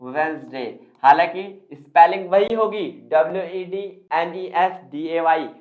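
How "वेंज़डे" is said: "वेडनेसडे" as wenz-day, silent D; two syllables in "वेडनेसडे", wenz-day; correct pronunciation. Shown as a demonstration. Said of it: "क्या लिखिए दोस्तों?". ("वेडनेसडे" को 'वेंज़डे' बोलें)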